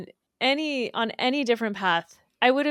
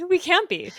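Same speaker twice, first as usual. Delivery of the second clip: higher, louder, through a laugh; laughing mock denial; 9 semitones higher, 5 dB louder than usual. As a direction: laugh while voicing a mock protest. Laughing along, voicing the old denial.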